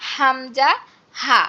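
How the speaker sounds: হামজা, হা released from an open throat; no squeezing at all on হামজা and হা; open-throat pronunciation. Shown as a demonstration. Teacher recaps the two letters, open-throat হামজা, হা. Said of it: "গলা ছেড়ে উচ্চারণ হবে".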